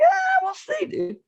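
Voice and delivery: a high, taunting ah, then a shrug of we'll see; cocky dismissal. Retold or imitated. Brushing off his mom's warning, high taunting ah. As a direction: wave her warning off with a singsong ah.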